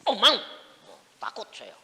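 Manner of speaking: ordinary talk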